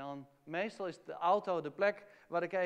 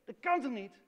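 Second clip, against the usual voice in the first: raised, higher, indignant grumbling; grumbling complaint; 5 semitones higher, 6 dB louder than usual. Retold or imitated. David grumbling and railing at God, raised voice. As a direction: rail at God, unable to understand. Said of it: "begint te mopperen en te schelden".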